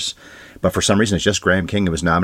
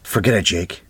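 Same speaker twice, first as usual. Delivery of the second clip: quoting the movie line. Retold or imitated. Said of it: "What is the actual quote?".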